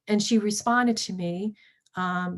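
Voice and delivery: ordinary delivery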